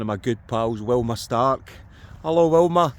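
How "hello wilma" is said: cheery loud hello. Real, not acted. To a pal watching, loud cheery greeting. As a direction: call the greeting out louder and higher.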